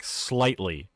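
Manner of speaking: usual speaking voice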